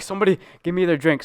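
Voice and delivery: loud, high, fast mock plea; retold desperate plea. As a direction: beg urgently, imitating a teammate in pain. Retold desperate plea after spicy chip.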